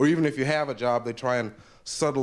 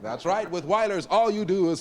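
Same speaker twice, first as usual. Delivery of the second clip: announcer read; 6 semitones higher than usual. Acted. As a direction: sell it like a radio sponsor spot. Announcer reads sponsor ad.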